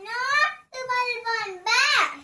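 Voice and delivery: very loud, high, drawn-out cry; loud calling out. Real, not acted. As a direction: belt it out slowly, stretching every word. Child repeats the loud call. (ഉറക്കെ, നീട്ടി വിളിച്ചുകൂവൽ)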